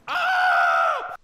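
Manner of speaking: a short bleating yell; goat-like scream. Pretend. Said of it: "That was quite goat-like".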